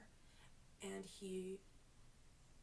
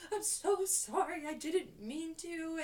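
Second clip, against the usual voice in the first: high, slow, wailing apology; mock crying; 7 semitones higher, 5 dB louder, slower than usual. New stylist apologizes to angry client, exaggerated tearful wail. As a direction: wail it like an imitated tearful meltdown. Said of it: "I started crying".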